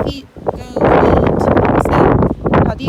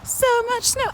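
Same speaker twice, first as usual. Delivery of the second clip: much higher, softer squeak; squeaky high voice; 12 semitones higher, 8 dB quieter than usual. Real, not acted. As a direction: squeak it out in disbelief. Repeat squeaked high and soft.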